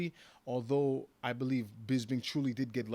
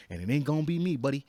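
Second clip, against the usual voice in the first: much louder, higher punch of defiance; defiant; 4 semitones higher, 10 dB louder, faster than usual. Real, not acted. Fighter warning his opponent Alessio, loud defiant boast. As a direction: slam the promise home at the end.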